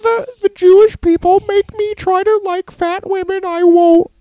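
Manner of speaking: very high-pitched mock declaration; indignant, high caricature voice taking a stand; Jordan Peterson. Host mimics a man refusing a magazine model, mock-indignant high caricature. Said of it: "the Jordan Peterson thing".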